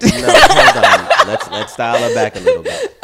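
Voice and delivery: laughing protest. Real, not acted. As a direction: stop them while cracking up. Stopping friends, cracking up.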